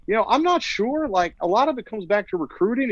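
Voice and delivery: high twangy voice; Norwood Teague impression. Reporter imitating the athletic director asking him, high twang. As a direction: pitch it way up with a twang. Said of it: "He just kinda had this high twang".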